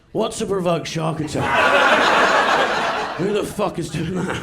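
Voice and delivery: drawn-out, disbelieving loud question; incredulous shout. Real, not acted. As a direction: yell it in slow disbelief. Incredulous outburst at the stat.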